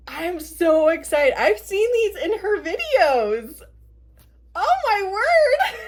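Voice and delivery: high gush rising to a squeal; thrilled, voice climbing; so excited. Real, not acted. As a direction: build to an overjoyed high cry.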